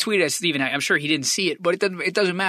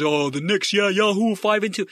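mocking doubter. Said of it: "any fucking time that somebody says".

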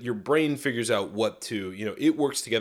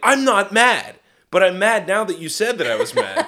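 loud, high mock indignation; hammed-up defensive yelling; imitated angry denial; 8 semitones higher, 7 dB louder than usual. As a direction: snap back as the irritated partner. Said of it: "you go like".

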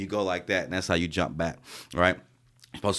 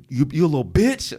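higher, louder sneering sergeant voice; mocking sergeant; 8 semitones higher, 4 dB louder than usual. Air assault sergeant taunting him after he failed, sneering. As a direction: taunt him like the instructor did. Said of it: "air assault sergeants was like".

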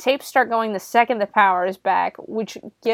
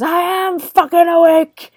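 loud, high imitated shout of frustration; exasperated outburst; 9 semitones higher, 9 dB louder than usual. Gordon after being told to wake up, exasperated shout. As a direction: blow up, punching out each word at full volume. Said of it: "Gordon finally just goes".

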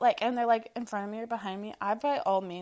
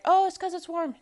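mock quote; 8 semitones higher, 8 dB louder than usual. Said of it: "You're like".

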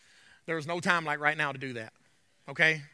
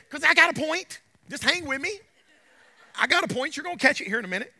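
higher, louder, quick and eager; eager insistence; 9 semitones higher, 5 dB louder than usual. Retold or imitated. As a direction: win the doubters over with eager energy. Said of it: "He's like".

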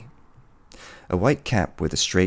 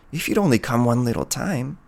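higher-pitched, wistful plea; pleading; 6 semitones higher than usual. Acted. Woman begging the hunter to visit, high and pleading. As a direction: beg him for just a little.

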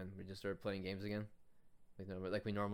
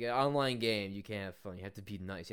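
usual speaking voice

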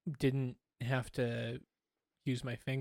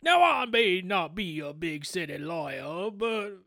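mock Southern lawyer; 5 semitones higher, 5 dB louder than usual. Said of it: "a lot of people walking around like".

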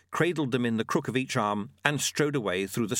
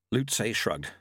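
usual speaking voice

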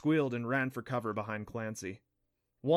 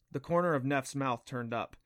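plain reading voice

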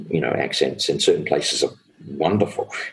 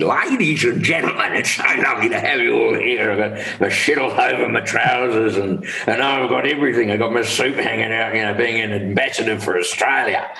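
Les Patterson; 4 semitones higher than usual. Acted. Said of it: "actually Les Patterson, you know, like".

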